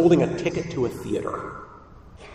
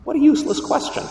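much higher, fast scoffing retort; scoffing coach; 12 semitones higher, faster than usual. Retold or imitated. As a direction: brush off the question like a gruff coach. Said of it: "Coach says".

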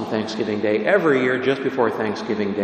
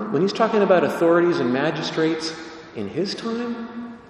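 normal talking voice

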